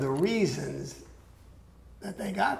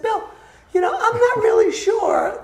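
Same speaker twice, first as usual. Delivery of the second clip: much higher, nervous. Retold or imitated. Telling Bill it's a bad idea, nervous and much higher. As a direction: voice jumping up with nerves. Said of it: "when I get nervous, my voice goes up".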